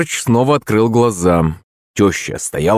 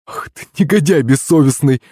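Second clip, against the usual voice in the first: angry scolding; 4 semitones higher than usual. Acted. Wife scolds drunk husband.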